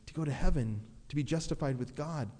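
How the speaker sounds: normal talking voice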